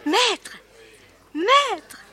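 high, eager call. Acted. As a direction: call him across the crowd. Jeune fille appelle l'auteur. (appel timide mais pressant)

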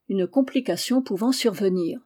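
plain reading voice

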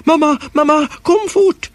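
high, urgent call of mamma, mamma; a boy shouting for his mother from the sofa; calling for mum. A narrator's reading. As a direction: call her over in a panic. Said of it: "Jag ropade efter morsan".

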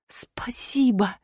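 faint slow whisper; whispered thanks. A narrator's reading. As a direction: barely breathe the thanks.

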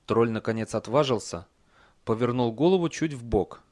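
ordinary delivery, nothing marked

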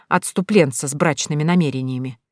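ordinary delivery, nothing marked

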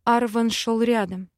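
plain reading voice

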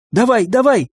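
high-pitched, insistent prompt; urgent urging. A narrator's reading. Eva urges Linda to cast now, urgent.

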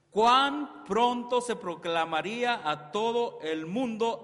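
loud, slow, ringing pulpit proclamation; full-force preaching. Acted. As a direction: preach it out to the congregation, stretching each word. (predicación a toda voz)

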